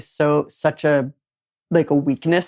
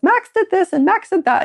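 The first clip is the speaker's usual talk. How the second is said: high, whiny mock tattling; whiny complaint; 14 semitones higher than usual. Griping to her dad about her husband, high mock whine. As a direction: jump way up and tattle like a sulky kid.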